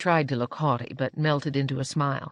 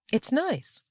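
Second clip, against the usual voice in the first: high-pitched, mildly surprised approval; evident surprise; 10 semitones higher than usual. A narrator's reading. Lady touching the settler's beard, evidently surprised. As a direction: lift it high, pleasantly taken aback. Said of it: "she said with evident surprise".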